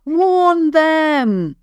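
loud urgent shout. Acted. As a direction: yell the warning out. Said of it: "and he shouted".

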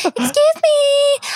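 very high, drawn-out tiny voice; little voice. Retold or imitated. Imitating the woman poking people's ribs, little voice. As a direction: stretch it slowly in a squeaky mock-sweet voice. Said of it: "like in that little voice".

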